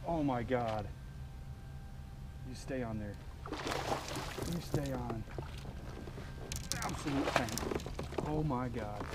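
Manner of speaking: hushed awe. Real, not acted. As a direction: breathe the words at the fish. Fighting a fish quietly.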